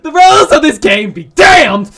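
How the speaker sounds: loud, high hammed-up cry; grand mock-dramatic shout; theatrical defiance. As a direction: declare it like a stage rebel. Defying the game theatrically.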